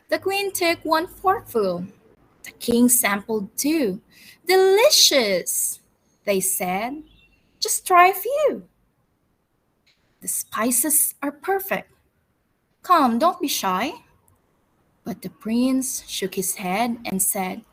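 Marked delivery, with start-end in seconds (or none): 4.5-5.8 a higher, louder, drawn-out "Delicious"; slow, savouring delight. Acted. King and queen praise the food. 7.6-14.0 put-on a higher, eager voice urging the boy; coaxing host. Host coaxes the prince.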